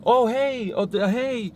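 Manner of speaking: high, overeager awkward greetings; awkward repeated hey. Retold or imitated. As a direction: play someone nervously greeting everyone at a party. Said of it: "go around and say".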